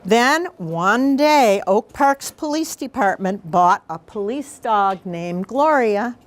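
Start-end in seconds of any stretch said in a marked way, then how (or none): none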